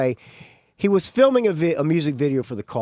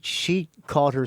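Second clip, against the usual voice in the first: normal talking voice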